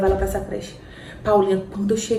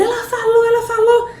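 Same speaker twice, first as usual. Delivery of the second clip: high, louder joyful telling; excited news; 13 semitones higher, 5 dB louder than usual. Retold or imitated. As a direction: blurt out the good news. (notícia eufórica)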